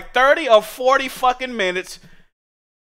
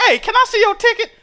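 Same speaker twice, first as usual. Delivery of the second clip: lightly pitched-up ticket checker; high chick voice; 12 semitones higher than usual. Retold or imitated. Theater worker stops him at the line, high chirpy question.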